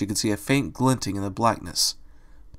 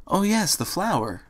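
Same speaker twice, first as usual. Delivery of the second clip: higher, soft little realization; gentle, high-pitched character voice; suddenly remembering; 7 semitones higher, 5 dB quieter than usual. A narrator's reading. Fluttershy remembers the flower petal, softly realizing. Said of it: "Fluttershy remembered".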